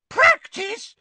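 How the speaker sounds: very high, loud incredulous shriek; piercing elf fury; furious squeal. A narrator's reading. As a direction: squeal the word in outrage. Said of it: "squealed Winky furiously".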